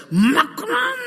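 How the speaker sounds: high strained cry of realisation; inner shout. Retold or imitated. Son realising inwardly, high strained cry. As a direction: cry it out inside your head. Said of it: "소리는 못 치잖아요. 그죠? 속에서".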